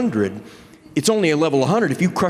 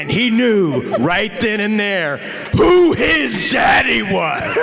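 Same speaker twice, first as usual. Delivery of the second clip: triumphant shout; 6 semitones higher, slower than usual. Real, not acted. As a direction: hammer home who won, slowly. Triumphant closing line of story.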